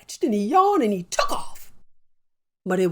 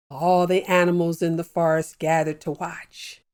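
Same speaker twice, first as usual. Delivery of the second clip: ordinary delivery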